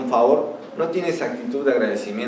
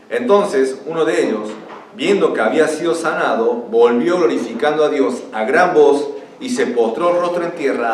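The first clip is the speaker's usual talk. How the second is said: raised, emphatic Bible reading; scripture reading; 4 semitones higher, 4 dB louder than usual. Acted. Preacher reading Jesus' command to the lepers, emphatic proclamation. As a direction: proclaim the verse to the room. Reads the scripture passage aloud.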